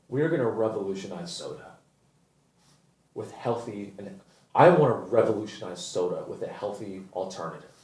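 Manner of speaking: lightly voiced earnest startup founder; mock founder. Mocking the Poppi founder's mission pitch, soft dreamy voice. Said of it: "she goes".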